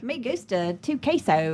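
put-on slow, Southern-accented Spanish; George W. Bush. Joining the Spanish riff, broad Southern accent. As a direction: drawl bad Spanish with American vowels. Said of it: "doing George W. Bush doing Spanish".

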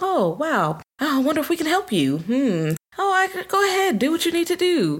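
high, sing-song fake helpfulness; sweet mock helpful. Amused villagers pretending to help him, high sing-song. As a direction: coo it, faux-innocent and eager to please. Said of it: "they're like".

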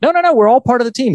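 high, fast, chirpy Ted protest; imitating Ted. Host imitating Ted reassuring Rebecca, high and fast. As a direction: squeak it out eagerly. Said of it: "he's like".

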